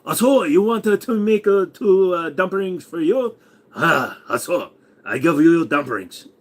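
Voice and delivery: hammed-up Vietnamese accent; mock Vietnamese vendor. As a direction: hawk dumplings in a put-on Vietnamese accent. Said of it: "dumpling makers on the street of Saigon".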